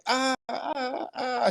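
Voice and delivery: drawn-out mock dying cries; hammy vampire burning in sun. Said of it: "go like".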